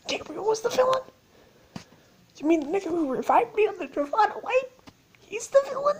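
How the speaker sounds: very high, squeaky disbelief; incredulous squeal. Retold or imitated. Player learning the villain's identity, incredulous high squeal. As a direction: squeak it out in mock shock.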